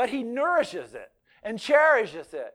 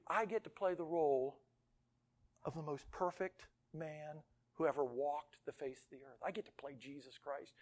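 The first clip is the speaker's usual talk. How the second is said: low, hushed, dawning dread; sobered husband; 5 semitones lower, 13 dB quieter than usual. Acted. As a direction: let the voice sink as the role sinks in. Husband reads his daunting role.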